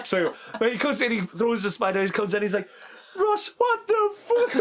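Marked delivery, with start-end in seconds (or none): 3.2-4.6 slow, very high-pitched disbelief; hammed-up incredulous squeal; mock shocked outrage. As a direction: imitate an aghast friend, drawn out and squeaky. Acts Scott's reaction to being painted.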